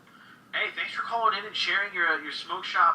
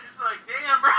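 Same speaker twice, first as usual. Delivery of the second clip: laughing; 8 semitones higher, 6 dB louder than usual. Real, not acted. Host laughing through words.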